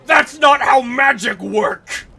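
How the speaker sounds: slow, loud, high brute bellow; barbarian voice. Retold or imitated. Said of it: "I gave Crafty's character, like, a barbarian voice".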